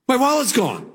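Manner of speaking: sudden worry on finding something missing; acted alarm. Tells of discovering wallet lost.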